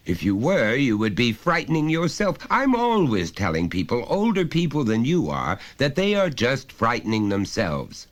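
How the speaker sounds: high, loud, lecturing father voice; a father talking down to his son, patiently insistent; stern father. Acted. Father pressing his small son, loud and high.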